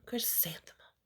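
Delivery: softly whispered name. Acted. Girl whispering her name to the bathroom mirror. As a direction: breathe the name to yourself. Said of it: "whispered it to herself".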